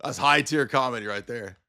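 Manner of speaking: ordinary talk, nothing marked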